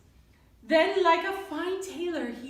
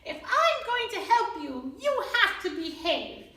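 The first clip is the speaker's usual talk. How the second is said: higher, firm little mouse; strong and brave mouse; 6 semitones higher than usual. Acted. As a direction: stand up to her bravely. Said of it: "with a voice strong and brave".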